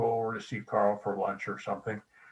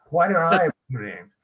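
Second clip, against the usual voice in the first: put-on louder and quick, a fed-up child whining; irritated kid's complaint; 4 semitones higher, 6 dB louder, faster than usual. Son home from school griping to his parents, irritated and fast. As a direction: complain like a third-grader who's had enough. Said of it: "really irritated".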